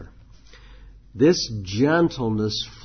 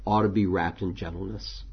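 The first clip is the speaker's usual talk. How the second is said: ordinary delivery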